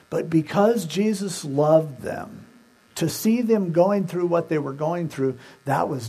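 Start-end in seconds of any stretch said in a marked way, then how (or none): none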